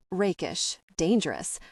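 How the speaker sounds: ordinary delivery, nothing marked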